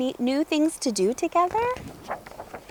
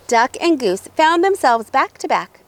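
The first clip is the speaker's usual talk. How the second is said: ordinary delivery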